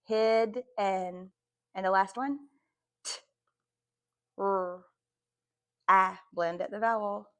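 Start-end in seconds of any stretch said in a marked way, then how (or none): 0.0-1.3 "hid" and "and" (en) as two separate chunks; syllable by syllable. Shown as a demonstration. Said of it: "Put the two syllables together". 3.0-6.2 "t", "r" and short "a", each alone with pauses; isolated phonemes. Shown as a demonstration. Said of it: "first sound".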